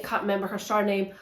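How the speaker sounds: usual speaking voice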